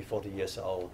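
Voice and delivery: normal talking voice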